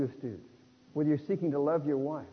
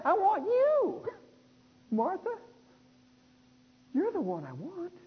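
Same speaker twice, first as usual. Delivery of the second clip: high, slow, tender plea; tender longing; slower than usual. Retold or imitated. Jesus telling Martha he wants her, tender. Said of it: "he's saying".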